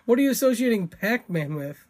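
ordinary delivery

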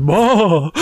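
mock Hank Hill yell. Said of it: "he's like".

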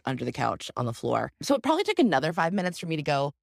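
ordinary talk

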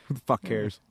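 normal talking voice

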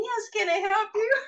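high, prim clerk voice; a tiny service clerk greeting; little guy voice. Retold or imitated. Small service guy greeting the customer, high and prim.

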